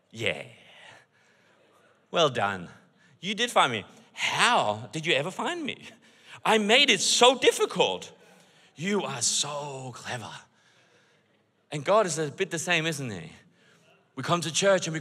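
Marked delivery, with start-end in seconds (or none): 0.1-10.3 indulgent parent. Retold or imitated. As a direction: humour the child gently. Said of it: "You go".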